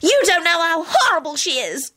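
high-pitched angry outburst; indignant high cry; angry scream. A narrator's reading. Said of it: "Solange screamed angrily".

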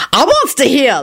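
high, insistent chime-in; stubborn. A narrator's reading. Lucretia demanding to hear gossip, stubborn. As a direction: pipe up and insist. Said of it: "Lucretia chimed in stubbornly".

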